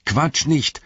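high, impatient call; ärgerliches Rufen. A narrator's reading. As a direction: call up to cut the babble short. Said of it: "rief Frodo". (hoch und ungeduldig gerufen)

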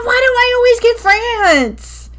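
loud whiny complaint. Real, not acted. Complaining about assignment.

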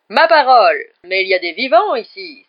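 loud, booming mock surprise; exclaiming. A narrator's reading. Headless knight spotting the living guests, loud exclamation. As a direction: cry out in astonishment. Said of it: "s'exclama Sir Patrick".

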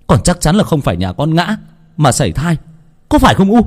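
high-pitched, heated accusation; red-faced outburst of reproach; angry shouting. A narrator's reading. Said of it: "bừng bừng mà quát".

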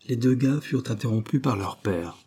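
usual speaking voice

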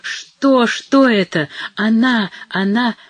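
high shrieking cry, slowing on Боже милосердный; an old woman screaming at the sight of a body; horrified scream, high and loud; terrified shriek. A narrator's reading. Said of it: "завизжала".

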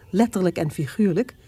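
ordinary talk

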